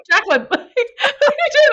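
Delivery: amused laughing question. Retold or imitated. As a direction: laugh through it, fondly teasing. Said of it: "She's like".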